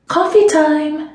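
high, bright sing-song announcement; announced. A narrator's reading. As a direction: call it out cheerfully to a friend. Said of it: "Maxine announced".

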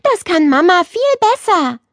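put-on a little girl sticking up for her mom; grumbling girl. A narrator's reading. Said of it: "meckerte Nele".

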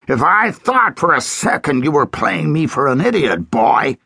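menacing sheriff. A narrator's reading. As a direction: threaten him, gun drawn. Sheriff threatens with gun.

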